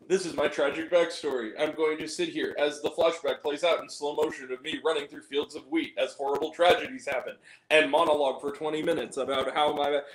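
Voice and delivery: low, monotone mock backstory monologue; tragic movie character. Reviewer mimics a character telling her tragic backstory, low mock monologue. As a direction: drone it out low, mocking the exposition. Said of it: "it's Sophia Patel's character".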